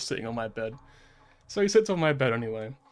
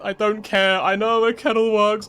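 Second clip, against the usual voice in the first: louder, higher, drawn-out fed-up snap; exasperated outburst; 7 semitones higher, 10 dB louder, slower than usual. Retold or imitated. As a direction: throw it back at him, slow and loud. Exasperated reply to dad's lecture.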